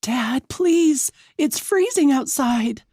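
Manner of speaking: high, desperate sister's plea; high-pitched pleading; begging. A narrator's reading. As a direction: beg your father to relent. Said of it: "Ava begs".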